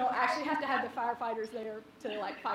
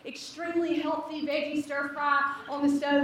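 ordinary talk

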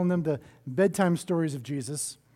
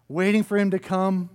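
ordinary talk

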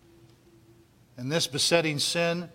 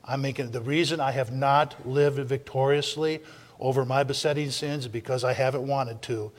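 ordinary delivery

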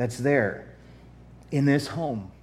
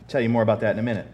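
ordinary talk, nothing marked

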